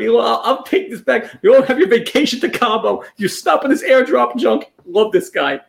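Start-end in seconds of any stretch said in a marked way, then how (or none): 0.1-4.7 mild mock yell, pitched up; mock yelling pilot. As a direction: imitate a pilot scolding the passengers. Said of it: "He yelled at them".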